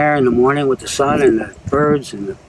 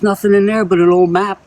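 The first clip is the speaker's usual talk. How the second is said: higher and louder innocent woman driver; 7 semitones higher, 5 dB louder than usual. Acted. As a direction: sound breezily innocent, voice up. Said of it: "She goes".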